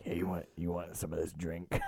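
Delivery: shady drink offer. Pretend. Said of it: "I feel like he would be like".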